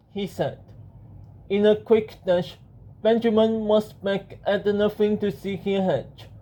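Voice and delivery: ordinary delivery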